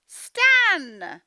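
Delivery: loud high call of Stan's name; mum calling. Acted. Teacher voicing mum, shouting for Stan. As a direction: yell for the boy like a cross mum.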